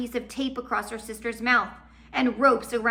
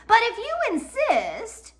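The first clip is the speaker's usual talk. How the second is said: sly villain; 6 dB louder than usual. Acted. Olaf mock-obliging threat.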